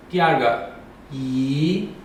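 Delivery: 一 rising from mid, slowly; second tone. Shown as a demonstration. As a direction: lift 一 upward like a question. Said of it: "第四个声调是一".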